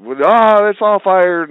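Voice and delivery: high, loud whining squeal; hammed-up panicked producer voice; mock whiny panic. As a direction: whine it like a flustered schemer. Comic guy's whiny firing claim.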